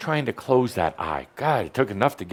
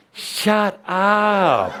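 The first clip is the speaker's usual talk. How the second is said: higher, drawn-out mock yell; mock shout; 9 semitones higher than usual. Teacher silencing the inner arguer, mock shout. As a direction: yell it comically at your own brain.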